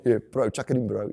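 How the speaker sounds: a loud, laughing hint of a New Zealand bloke; New Zealand accent. Playing up the accent for the interviewer, in a light New Zealand accent. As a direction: play a laid-back New Zealand bro, lightly. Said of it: "your best New Zealand accent".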